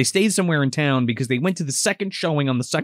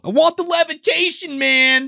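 much higher, louder demand; demanding shout; 12 semitones higher, 3 dB louder than usual. Retold or imitated. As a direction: yell it at the magician like a brat. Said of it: "yelling at this guy".

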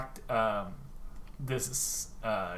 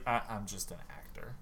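sheepish mumble; 4 semitones lower, 13 dB quieter than usual. Retold or imitated. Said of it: "And he's like".

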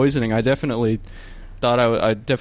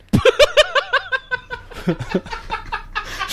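high giggle. Real, not acted. Laughing at own joke.

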